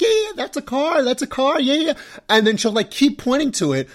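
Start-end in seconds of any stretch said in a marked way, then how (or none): 0.0-1.9 dismissive brush-off. Retold or imitated.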